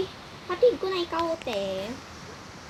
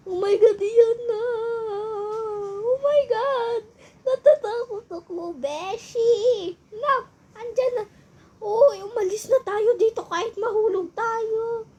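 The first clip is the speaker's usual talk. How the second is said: panicky; 7 semitones higher, 3 dB louder than usual. Acted. Panicking on the ride.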